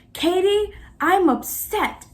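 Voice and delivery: student bursting into the director's office, upset; retold upset. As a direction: storm in and announce it. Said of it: "stormed into her office".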